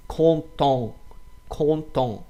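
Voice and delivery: content with both vowels nasalized; nasal vowels. Shown as a demonstration. Teacher modeling content for students. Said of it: "also from nose".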